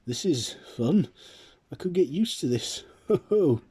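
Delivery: high, loud booming ho ho laughter; jolly shout. Acted. Nicholas overjoyed flying the sleigh, jolly shouting. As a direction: shout it like a thrilled Santa. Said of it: "he shouts".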